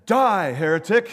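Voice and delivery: loud, punched comic denunciation; mock condemnation. Bystander turning on the jumper, mock-angry shout. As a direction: shout it as a sudden verdict.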